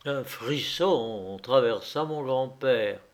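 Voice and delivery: usual speaking voice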